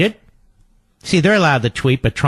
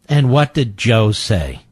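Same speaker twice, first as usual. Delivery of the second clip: ordinary talk, nothing marked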